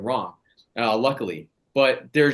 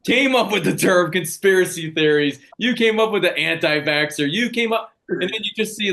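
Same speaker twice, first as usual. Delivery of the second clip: higher, louder, accusing outburst; a heated, raised-voice tirade; angry rant; 6 semitones higher, 4 dB louder than usual. Real, not acted. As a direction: jab each "you" at the CIA like an accusation. Ranting at the CIA.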